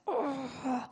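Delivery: soft, breathed-out groan; groan in the spirit. Acted. Preacher imitating Jesus groaning before the Father, soft groan. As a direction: groan softly from within. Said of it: "he groaned in the Spirit".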